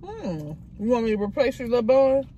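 chewing while talking; talking around a bite of bun; mouth full. Real, not acted. Seen 0: holds bitten bun, lips pursed and moving after the bite.

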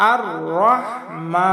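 slow recitation, long held MA in "রহমা"; drawn-out recitation. Shown as a demonstration. Teacher showing learners the alif stretch, slow drawn-out recitation. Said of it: "উপরে এক আলিফ টান".

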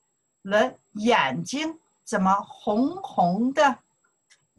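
slow, careful classroom reading; slow model sentence; slow model line. Shown as a demonstration. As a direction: drag it out so learners can follow. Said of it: "Follow me to pronounce here".

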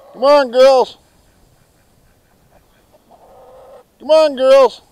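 loud, high holler across the yard; calling hens. Real, not acted. Keeper calling his girls over, high carrying shout. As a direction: call the flock in, loud and high.

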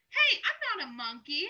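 ordinary delivery

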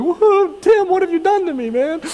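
hammed-up despair at his brother; mock meltdown. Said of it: "melted down crying".

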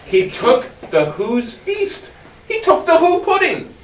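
from steady telling to a laughing shout; building excitement. A narrator's reading. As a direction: pile on the thrill item by item. Building list of stolen food.

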